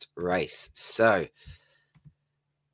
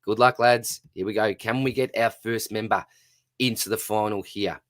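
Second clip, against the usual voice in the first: usual speaking voice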